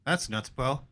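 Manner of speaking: low, soft, indifferent bro voice; mock bored bro. Gunman after the shooting, mock unbothered bro. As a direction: shrug it off like nothing happened. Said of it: "Instead, he's like".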